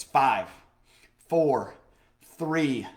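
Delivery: a countdown building up before the jump. Retold or imitated. Countdown on the bridge.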